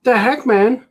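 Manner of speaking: hammed-up bewildered outburst; mock freaking out. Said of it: "She's freaking out".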